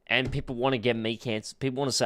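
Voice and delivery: ordinary delivery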